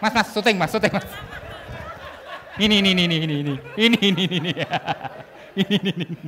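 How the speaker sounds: loud laughing calls; laughing, pointing someone out. Real, not acted. To the audience, pointing someone out, loud and laughing.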